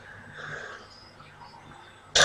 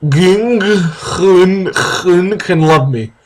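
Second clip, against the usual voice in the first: higher, slowed stumble over Ging Hun; mispronounced name, laughing; 5 semitones higher, much slower than usual. Acted. Heroine's name reading dissolving into laughter. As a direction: trip on the name and crack up.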